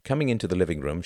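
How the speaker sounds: usual speaking voice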